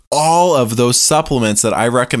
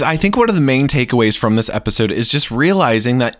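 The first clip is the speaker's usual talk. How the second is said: ordinary talk, nothing marked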